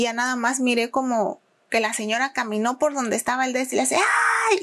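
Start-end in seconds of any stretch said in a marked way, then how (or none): 4.0-4.6 high sharp imitated "ay" cry; mock scream. Woman faking a fall in the store, high cry. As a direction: squeal it like a startled old lady. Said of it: "como que dio el grito".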